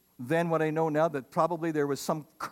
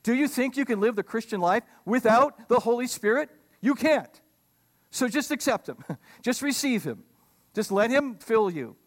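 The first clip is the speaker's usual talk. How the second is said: higher, urging appeal to the church; pleading Paul; 5 semitones higher, 3 dB louder than usual. Retold or imitated. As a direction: coax them like a frustrated friend. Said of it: "He's saying".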